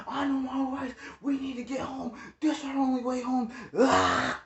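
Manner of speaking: mock the cousin crying about his rights; whiny cousin. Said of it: "He's sitting there yelling, screaming".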